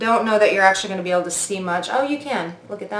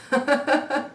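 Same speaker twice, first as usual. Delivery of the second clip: higher, softish chuckle of disbelief; amused laugh; 5 semitones higher, 4 dB quieter than usual. Real, not acted. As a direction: let out a surprised little laugh. Amazed laugh at the glow.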